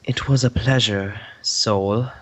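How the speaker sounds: smooth and melodious. Acted. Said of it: "his voice remained smooth and melodious".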